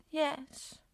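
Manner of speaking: soft, high, downcast little yes; child sadly accepting. A narrator's reading. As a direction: say it with quiet disappointment. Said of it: "She sounded so sad about it".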